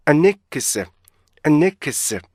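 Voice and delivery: "unexpected" begun as linked a-NEK-se, first part only; joined first half. Shown as a demonstration. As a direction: blend un into the next vowel in "unexpected". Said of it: "이렇게".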